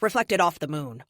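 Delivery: ordinary delivery, nothing marked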